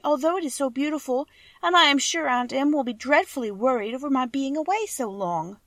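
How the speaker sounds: a hint of a meek child asking for help; lightly voiced little girl. A narrator's reading. Dorothy voice.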